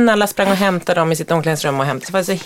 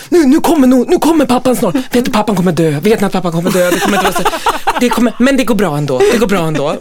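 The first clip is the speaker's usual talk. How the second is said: put-on higher, louder eager kid chatter with laughs; excited child; 4 semitones higher, 4 dB louder than usual. Son excitedly tells his friends what happens next. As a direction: babble like a thrilled boy spoiling the plot.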